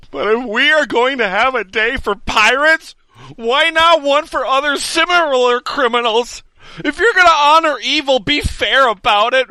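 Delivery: rising indignant rant, building to a shout; hammed-up righteous fury; mock outrage.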